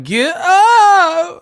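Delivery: high, drawn-out falsetto yell. Retold or imitated. Streamer promising to jeer when [name] tackles him, squealed yell. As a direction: squeal it as a mocking imitation. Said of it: "I'ma be like".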